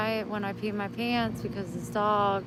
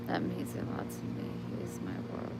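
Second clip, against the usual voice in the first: ordinary talk, nothing marked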